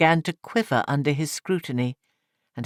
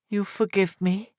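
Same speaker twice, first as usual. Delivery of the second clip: crying; 6 semitones higher than usual. A narrator's reading. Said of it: "she said, crying".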